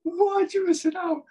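very high falsetto outrage; incredulous, way up in pitch; playful disbelief. Real, not acted. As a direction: squeak it out in exaggerated shock. Mock shock at co-host's ignorance.

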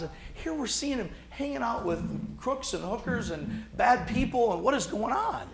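high, baffled mock outrage of critics; outsiders baffled. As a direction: play the scandalised onlookers, pitch up high. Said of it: "they're like".

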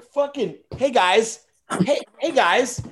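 high-pitched urgent plea to the guys; hoog smekend stemmetje. Acted. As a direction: squeal it out high, pestering the guys.

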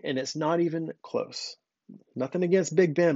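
ordinary talk

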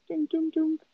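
mock knock, high and soft; knocking sound. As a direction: tap out a knock with your voice. Imitating a door knock.

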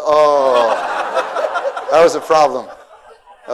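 laughing. Real, not acted. Laughs at the story.

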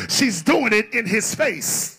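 loud sermon building to a high shout; full-force preaching. Real, not acted. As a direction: preach it at full volume, climbing to a peak.

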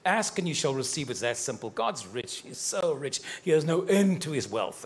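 usual speaking voice

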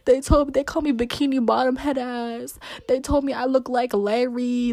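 imitating tearful complaint. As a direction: wail it out like retelling a crying fit. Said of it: "I'm crying to her".

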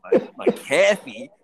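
"coffee" as "caffee", high and slow; mock the word "coffee" as "caffee", incredulous; mocking mispronunciation. Mocks the faked pronunciation of coffee.

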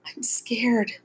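frightened, whispery high whimper. A narrator's reading. Scared girl to her brother in the dark, whimpering. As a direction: whimper it softly, barely voiced. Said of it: "Becca whimpered".

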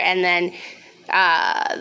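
usual speaking voice

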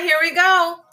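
ordinary delivery

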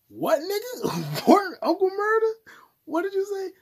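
very high, loud mock disbelief; incredulous outburst. Podcaster reacting to a wild rap lyric, incredulous high yelp. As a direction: squawk it in hammed-up shock.

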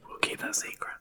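unvoiced conspiratorial whisper. Real, not acted. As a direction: whisper it like a secret. Joking secret aside.

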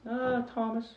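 tiny, high, soft voice; hesitant messenger. Acted. Messenger gives his name.

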